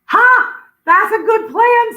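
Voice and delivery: high, bright ducks cheering over a clever plan; delighted ducks. Acted. Ducks hearing the secret plan cheer in delight.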